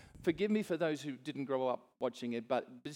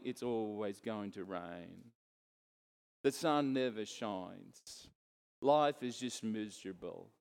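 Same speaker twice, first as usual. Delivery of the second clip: low, slow, mopey lament; glum donkey voice; 6 semitones lower, slower than usual. Retold or imitated. Preacher mimicking a gloomy donkey, low and slow. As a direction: sag every word like a droopy donkey. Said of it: "ears down, head's down".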